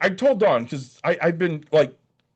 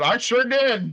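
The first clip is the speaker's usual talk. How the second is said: indignant retort; 8 semitones higher, much slower than usual. Retold or imitated. Retort to lady asking about drive.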